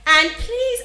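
high, stressed please; emphatic plea. Real, not acted. Host urging listeners to attend, emphatic please. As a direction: lean hard on please. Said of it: "I want to bring something to your notice".

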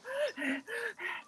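sharp high gasp for air; breathless gasp. Retold or imitated. Mom reliving her run from the snake, breathless gasp. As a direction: gasp like you're running out of breath.

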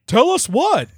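high, loud demand; angry. A narrator's reading. Randy demanding an answer from his son, angrily. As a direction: demand it like a cross cartoon dad. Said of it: "Randy asked Stan angrily".

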